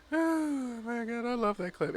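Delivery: high, squeezed voice after a laughing fit; spent from laughing. Real, not acted. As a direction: sigh it out high, worn out with laughter. Winded after laughing at clip.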